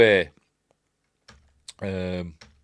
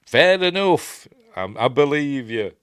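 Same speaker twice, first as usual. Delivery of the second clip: grudging agreement; 6 semitones higher, 6 dB louder than usual. Retold or imitated. Limbani wins over Peter.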